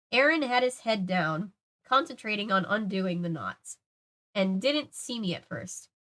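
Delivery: usual speaking voice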